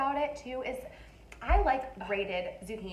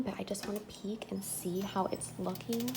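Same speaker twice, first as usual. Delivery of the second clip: whispering; 6 dB quieter than usual. Real, not acted. Sneaking a peek at oven.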